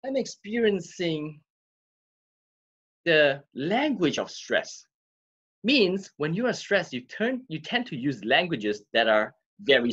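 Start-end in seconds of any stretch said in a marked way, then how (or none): 0.0-4.9 slow and deliberate, drawn out; calm slow reframing. Shown as a demonstration. Coach demonstrating calm self-talk after power breathing. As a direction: breathe and name it calmly. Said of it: "Let me just do some power breathing".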